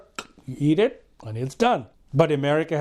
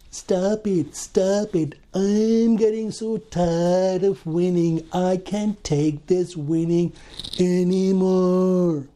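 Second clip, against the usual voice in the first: slow, drawn-out mock pleading; mock exasperation; 3 semitones higher, slower than usual. Comedian begging for an end to all the winning, mock exasperated. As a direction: stretch it out as a hammed-up weary protest.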